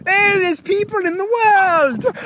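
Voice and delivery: high, slow mocking wail; hammed-up whine about people in the world. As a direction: mimic him with a whiny cry. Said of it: "mocking S1's topic".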